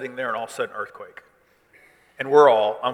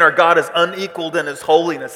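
loud, high proclamation; crying out; 5 semitones higher, 12 dB louder than usual. Acted. Angels praising God over the throne, crying out loudly. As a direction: declare it at full force like worshipping angels. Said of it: "as they're crying this out".

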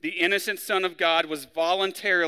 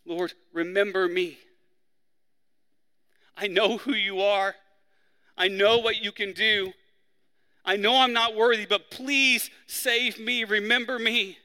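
rising, pleading plea that slows at please save me; crying out; 5 semitones higher, 3 dB louder than usual. Retold or imitated. Preacher voicing the dying criminal begging Jesus, pleading. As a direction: beg for mercy, building then easing. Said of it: "he cries out to Jesus".

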